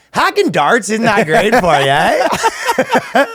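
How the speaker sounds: high, hammed-up Canadian with a rising "eh"; mock Canadian translator; Canadian accent. Canadian translator relaying a smoking warning, mock Canadian accent. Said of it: "They have a Canadian translator".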